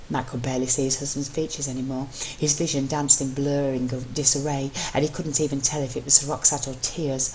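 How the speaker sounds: ordinary delivery, nothing marked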